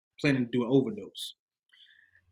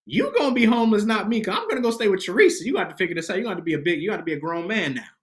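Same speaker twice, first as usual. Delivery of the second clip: scolding mom; 7 semitones higher than usual. Retold or imitated. Mom telling son to figure it out, retold scolding. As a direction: imitate a mother laying down the law.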